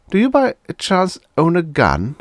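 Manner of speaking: high, loud, sly question; sly boss voice. A narrator's reading. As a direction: fish for the answer.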